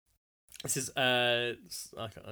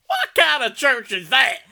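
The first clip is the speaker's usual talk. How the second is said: high, loud, incredulous American; put-on American accent; 10 semitones higher, 9 dB louder than usual. Reading an Atlanta commenter's outrage, in a loud American accent. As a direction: exclaim it in a hammed-up American accent. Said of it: "Why is this all American?".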